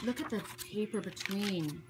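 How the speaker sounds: soft, slow murmurs of wonder; real hushed amazement; hushed awe. Real, not acted. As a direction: marvel quietly, taking your time. Awestruck while looking through gift book.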